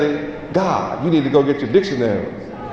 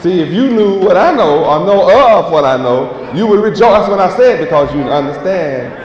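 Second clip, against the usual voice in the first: louder preaching, rising on eternal; street preaching; 3 semitones higher, 3 dB louder than usual. Acted. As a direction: proclaim it to the crowd like a street preacher. Preacher proclaims to passersby.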